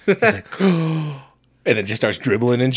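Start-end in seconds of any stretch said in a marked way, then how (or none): 0.0-1.2 sharp mock gasp, pitched up; imitated gasp. Friend retelling a commercial, sharp mock gasp. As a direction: gasp like someone startled. Shorty gasps at scary ball.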